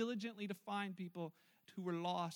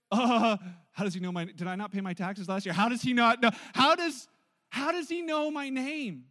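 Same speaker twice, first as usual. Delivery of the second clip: loud, flustered panic; startled citizen panicking that the prime minister knows him; stunned; 11 dB louder than usual. Acted. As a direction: blurt it out, loud and rattled. Acted stunned reaction to Trudeau.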